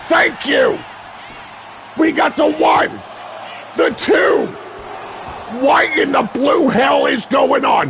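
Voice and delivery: hyped wrestling announcer riding every big move; live play-by-play. Acted. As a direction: call the match live with big excited shouts. Live wrestling match commentary.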